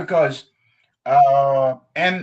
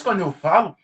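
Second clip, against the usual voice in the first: normal talking voice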